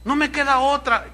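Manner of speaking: hammed-up anxious mother; desperate widow. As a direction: beg the pastor for an answer. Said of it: "dice otra".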